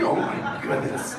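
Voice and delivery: slow, breathy, laughing exasperation; exasperated sigh. Retold or imitated. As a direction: mimic a dad giving up and walking away. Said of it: "he just walks off like".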